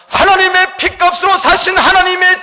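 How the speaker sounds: loud, high climactic preaching; preaching at full force. Real, not acted. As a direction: proclaim it to the whole congregation.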